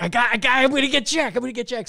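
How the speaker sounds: high, loud gleeful plotting; giddy mock-villain glee; gleeful scheming. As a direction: crow like a prankster with a plan. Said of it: "I'm like".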